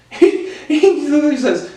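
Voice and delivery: real laughter breaking into words, pitched high; laughing. Real, not acted. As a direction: struggle to speak through a laugh. Laughs while introducing uncle's words.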